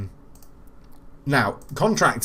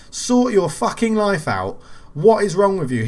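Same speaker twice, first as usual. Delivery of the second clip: slow, higher, louder scolding; angry rant; 7 semitones higher, 5 dB louder than usual. Real, not acted. Streamer berating the match engine, slow angry rant. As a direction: tear into the game in real anger. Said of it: "I'm absolutely furious at the game".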